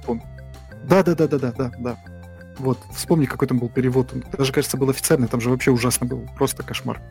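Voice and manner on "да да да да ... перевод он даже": usual speaking voice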